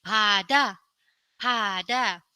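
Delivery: pada said clearly twice; word demo. Shown as a demonstration. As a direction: model pada for learners. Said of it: "saya memberikan".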